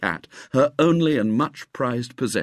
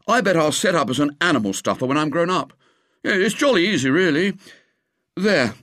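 higher, quick, breezy schoolboy brag; lightly voiced boasting boy; 8 semitones higher, 3 dB louder, faster than usual. A narrator's reading. William bragging about stuffing the cat, quick boy voice. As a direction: show off to the others.

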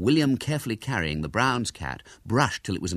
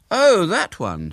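high, loud, drawn-out realisation; smiling; 10 semitones higher, 8 dB louder, slower than usual. A narrator's reading. William belittles his rival's circus, smiling. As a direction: let a smile into a slow mock realisation.

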